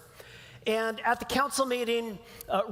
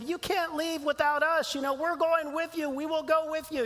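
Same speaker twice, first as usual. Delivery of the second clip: high-pitched pleading hobbit voices; high, eager hobbit impression; Merry and Pippin; 7 semitones higher than usual. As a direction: pitch it up as little hobbits insisting on coming along. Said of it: "Merry and Pippin".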